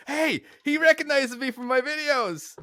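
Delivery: high, drawn-out excited announcement; mock bragging. Man showing off to his gym buddy, high and slow, mock-excited. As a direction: crow it slowly, way up in pitch.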